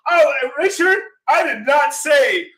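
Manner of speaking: high, loud, drawn-out objection; exasperated retold outburst; indignant protest. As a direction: protest loudly, stretching the words. Retold protest at Richard's exaggeration.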